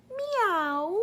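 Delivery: high, rising meow; high playful rising meow; cat meow. Shown as a demonstration. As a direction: mew like a kitty. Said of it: "the cat says".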